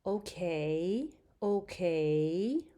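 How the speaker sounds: "Okay" dipping then rising, in agreement; agreeing rising okay. Shown as a demonstration. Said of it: "that's when you agree".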